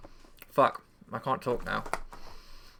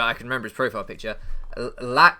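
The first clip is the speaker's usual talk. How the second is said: ordinary talk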